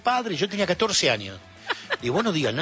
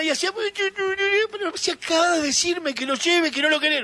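retold playful protest; 14 semitones higher than usual. Father cooing, then son protesting.

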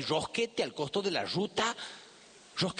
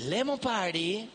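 Lemon Party lifted higher and slowed, savouring it; repeated name, brighter; 4 semitones higher, slower than usual. Shown as a demonstration. Echoing the centrepiece name to the guests, higher and savoured.

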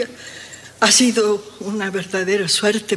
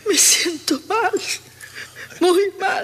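high, tearful and faint; llanto desfallecido; 8 semitones higher than usual. Acted. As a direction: whimper as if about to pass out. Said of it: "No llores así". (quejido lloroso, a punto de desmayarse)

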